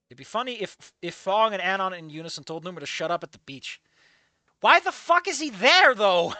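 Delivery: normal talking voice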